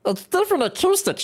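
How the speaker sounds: high teasing impression of a friend; mocking her. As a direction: parrot her words in a teasing squeaky voice. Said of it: "she says".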